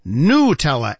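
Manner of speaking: Nutella as NOO-tella, pure oo vowel; Italian oo sound. Shown as a demonstration. Host demonstrates one listener's rule, Italian oo sound. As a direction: give Nutella a clear oo with no y glide. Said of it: "with the Italian oo sound".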